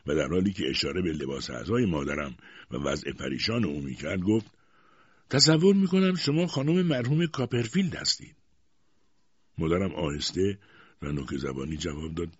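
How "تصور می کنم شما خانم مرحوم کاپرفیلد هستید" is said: high, loud, imperious demand; stern aunt. A narrator's reading. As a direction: confront her like a formidable aunt.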